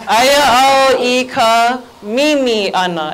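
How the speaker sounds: slow, drawn-out "Aya au i ka mimi ana"; slow teaching model. Shown as a demonstration. Teacher showing the class a new sentence, slowly modelled. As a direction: stretch each word for learners to copy. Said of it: "Everybody say".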